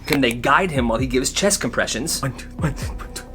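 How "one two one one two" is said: counting under breath. Acted. Counting chest compressions.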